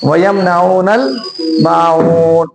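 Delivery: वयम नऊनल then माऊन with long मा and ऊन; measured recitation. Shown as a demonstration. Teacher recites for students, माऊन drawn out. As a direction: hold the long vowels in माऊन. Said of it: "कब आपको कितना खींचना है".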